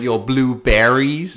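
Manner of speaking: "blueberries" loud, high and very drawn out with full "berries"; slow, loud, over-pronounced "blueberries"; exaggerated pronunciation. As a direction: stretch "blueberries" into an imitation of the local accent. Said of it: "how can I say it differently".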